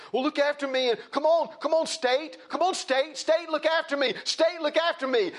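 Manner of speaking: high pleading whine begging the state; mocking entitled whine. Preacher mocking people who beg the state, high whiny plea. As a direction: beg like a spoiled child. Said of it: "an attitude of like".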